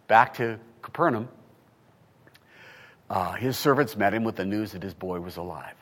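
normal talking voice

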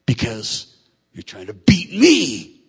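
slow, building to a mock shout; imitated competitive resentment. Men resenting a rival coach yelling, indignant shout. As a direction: stretch it out and get loud at the end.

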